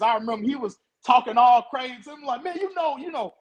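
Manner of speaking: normal talking voice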